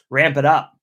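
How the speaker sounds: loud, pushed cheer to the viewer; ramp it up. Shown as a demonstration. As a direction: pump him up loudly. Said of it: "Be positive".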